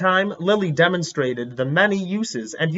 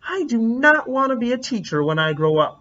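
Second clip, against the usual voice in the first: higher, stubborn stress on not; march it out like a stubborn kid; defiant huff; 6 semitones higher than usual. Acted. Marching out of class, a defiant little girl's vow. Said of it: "as she marched out".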